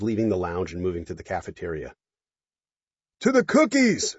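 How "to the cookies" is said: high loud party shout. Acted. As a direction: shout it to the crowd. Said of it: "somebody shouted".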